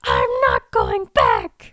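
slow, very low, loud demon croak; hammed-up defiant demon voice; Kathy, female demon. Runaway demon refuses to go home, in a mock demon voice. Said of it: "I'm trying to be a female demon".

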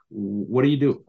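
ordinary talk, nothing marked

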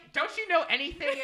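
high, loud mock scolding; imitating parents. Son mimics parents scolding his podcast mistakes, high and loud. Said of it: "they were like".

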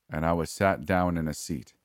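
plain reading voice

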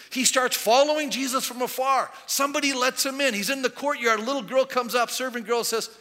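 loud, high sermon narration; full-force preaching. Acted. Preacher telling of Peter in the courtyard, full-force preaching.

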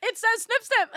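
high, loud giggling repeat; laughing. Real, not acted. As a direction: laugh while saying it, up high. Laughing at the quote.

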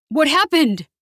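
high, alarmed yell; startled, urgent shout of a question. A narrator's reading.

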